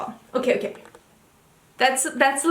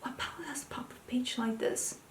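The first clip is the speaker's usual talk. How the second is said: soft breathy whisper of the clue; 13 dB quieter than usual. Real, not acted. Reading out the mystery palette's clue in a hushed whisper. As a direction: murmur the question under her breath.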